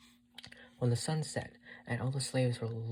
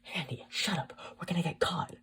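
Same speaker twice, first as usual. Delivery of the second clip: higher, tense warning; urgent scolding; 7 semitones higher, 3 dB louder than usual. A narrator's reading. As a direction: shut the child up before being caught. Mother anxiously silences her son.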